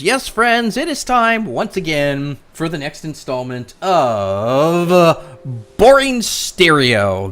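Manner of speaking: hammed-up show-host intro, drawn out and loud at the end; playful wrestling announcer build-up; mock announcer. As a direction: announce the segment like a ring announcer.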